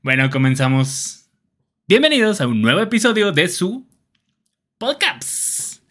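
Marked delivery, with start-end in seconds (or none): 1.9-5.8 show-opener announcement. Real, not acted. Host welcoming listeners to a new episode, high announcer greeting.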